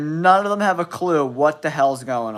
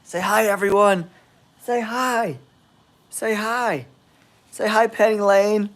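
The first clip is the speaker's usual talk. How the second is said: raised pitch, talking to a dog; higher, playful dog-owner voice; pet talk; 5 semitones higher than usual. Real, not acted. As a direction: coax your dog to greet everyone. Coaxing his dog to greet viewers.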